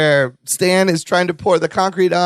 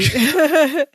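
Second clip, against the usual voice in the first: imitating Stan; 10 semitones higher than usual. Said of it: "I'm sorry".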